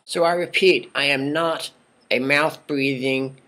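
ordinary talk

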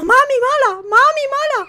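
put-on high, loud whiny baby. Player voices the shaking baby blaming mum, high whine. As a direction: sulk it like a cross toddler. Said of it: "Como diciendo".

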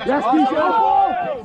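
shouting. Real, not acted. Coach cheering player on.

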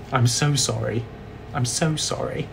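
low, soft apology; subdued, low-voiced sorry; hushed apology. Real, not acted. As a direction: apologise quietly, pitched well down. Apologising after teasing Americans.

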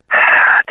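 audible, steadying breath; deep breath. Retold or imitated. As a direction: act out a big breath before speaking. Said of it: "take a deep breath".

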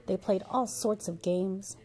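usual speaking voice

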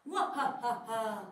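slow, cartoon-evil cackle; evil villain laugh.

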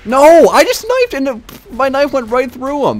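high, slow, loud disbelief; incredulous outburst. Real, not acted. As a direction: cry out in disbelief. Knife fails in game.

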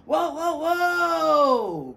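high loud wailing whoa; germ crying out. Acted. Sore throat germ crying out while swirling down the drain. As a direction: wail it as you spin away. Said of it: "cried the germ".

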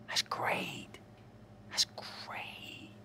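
gasp, then a whispered sarcastic "That's great"; mock gasping whisper. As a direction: fake awe, whispered. Mock delight at witness protection.

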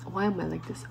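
softly lamenting; quiet self-reproach. Real, not acted. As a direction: say it under your breath to yourself. Same question repeated, quiet then loud.

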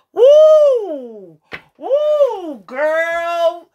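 high, loud whoops of shock; excited squeal. Real, not acted. Reader reacting to a card spread, high excited whoops. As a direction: shriek it out like the cards just floored you.

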